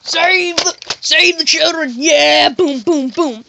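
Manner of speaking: loud, high mock battle cries; action-hero yelling. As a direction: shout it like a blockbuster hero. Acting action-movie hero in shootout.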